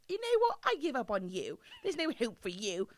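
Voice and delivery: mock English-accented boss giving up; put-on English accent, dismissive. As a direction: lightly imitate an exasperated boss with an English accent. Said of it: "They would be like".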